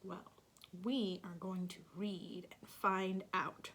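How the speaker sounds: ordinary delivery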